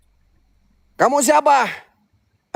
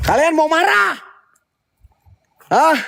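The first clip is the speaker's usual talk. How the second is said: much higher taunting challenge on "marah"; provoking spirits: "Kalian mau marah?" high and sharp; 10 semitones higher than usual. Real, not acted. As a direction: dare them, pitched up high.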